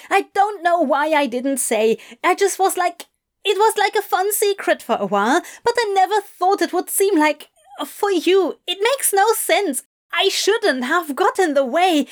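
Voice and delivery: high-pitched, agitated tumble of words; distraught Adam. Acted. Adam fumbling an explanation to Crowley, distraught.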